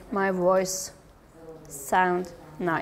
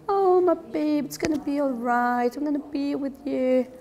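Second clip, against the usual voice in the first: put-on sweet baby talk; 5 semitones higher than usual. Shown as a demonstration. Teacher shows how to comfort a little baby, sweet and high. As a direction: soothe a crying infant. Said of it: "sweet, low, little bit high-pitched voice".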